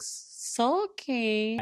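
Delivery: put-on high, drawn-out childish echo; mock child voice. Podcaster imitating her repeating a word, mock child voice. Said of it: "repeating, like, how a child would".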